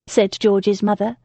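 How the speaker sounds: plain reading voice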